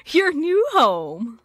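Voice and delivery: slow, drawn-out salesy pitch; real estate agent voice. Acted. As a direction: present the home like a realtor. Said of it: "sounding like a real estate agent".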